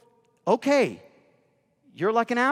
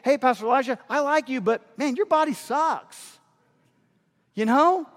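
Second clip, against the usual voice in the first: higher, cheeky teasing voice; mock rude critic; 9 semitones higher, 4 dB louder than usual. Imagined critic mocking the pastor's weight, cheeky and high. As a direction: jab at the pastor like a blunt friend. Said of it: "someone looking at me saying".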